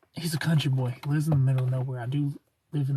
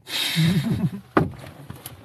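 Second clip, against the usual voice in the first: laugh. Real, not acted. Laughing at own brag.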